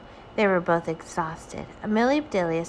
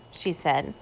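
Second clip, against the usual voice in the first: plain reading voice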